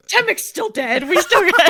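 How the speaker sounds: high, loud teasing objection; mock protest. Real, not acted. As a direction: tease the GM about the dead character. Player protests the happy ending.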